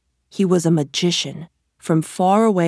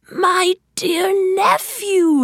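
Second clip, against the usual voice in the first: slow, high, put-on stranger voice; the false uncle calls out, much higher; crying out as the stranger; 13 semitones higher, slower than usual. A narrator's reading. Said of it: "he cried".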